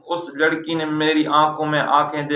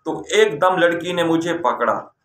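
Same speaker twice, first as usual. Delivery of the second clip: ordinary talk, nothing marked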